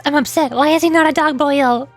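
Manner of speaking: ordinary talk, nothing marked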